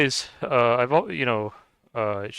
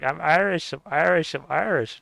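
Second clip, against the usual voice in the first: lightly mimicked, higher-pitched protest; mock insistence; 6 semitones higher, 4 dB louder than usual. Streamer mimicking friend's claim to be Irish, mock insistent. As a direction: insist on it three times, teasingly imitating her.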